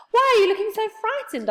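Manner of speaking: very high, loud concern; surprised question. A narrator's reading. Moonface finding Silky scared, asking high and loud. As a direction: ask in a high, startled voice.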